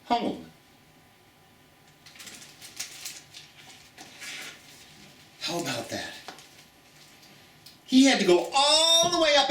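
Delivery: put-on gentle read-aloud storytelling for children; story reading. Teacher reading a picture book aloud to her students, storytelling.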